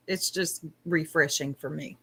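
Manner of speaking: normal talking voice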